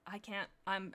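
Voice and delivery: plain reading voice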